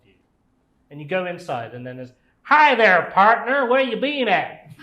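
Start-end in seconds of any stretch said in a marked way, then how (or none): 2.4-4.7 high, loud cowboy greeting; cowboy boots voice. Acted. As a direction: holler it like a hammed-up cowboy. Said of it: "That's a weird accent".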